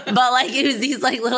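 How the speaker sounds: laughing through the words; words bubbling with laughter. Real, not acted. Telling a friend the story, laughing.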